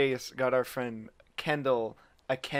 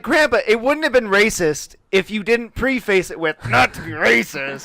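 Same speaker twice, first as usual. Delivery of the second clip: louder, higher exasperated retort; 8 semitones higher, 8 dB louder than usual. Retold or imitated. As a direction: scold grandpa in disbelief. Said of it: "we're just like".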